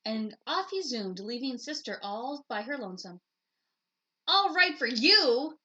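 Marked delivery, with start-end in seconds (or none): 4.3-5.6 put-on loud, high, slow shout of a hurt little girl. A narrator's reading. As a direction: yell it after the leaving brother, drawn out. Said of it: "she shouted".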